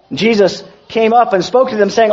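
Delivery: ordinary delivery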